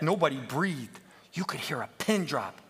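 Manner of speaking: usual speaking voice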